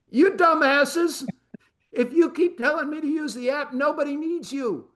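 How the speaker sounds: high, strained mock outburst at the bank staff; exasperated inner rant. Investor venting at young bank clerks, high exasperated rant. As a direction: rant at them in a pinched, incredulous voice. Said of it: "all I thought to myself".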